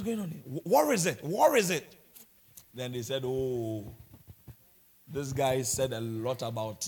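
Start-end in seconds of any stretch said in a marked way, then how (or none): none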